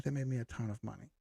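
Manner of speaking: a quick soft repeat; quick soft aside. Real, not acted. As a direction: say it again under your breath, quick. Quieter, faster repeat of the line.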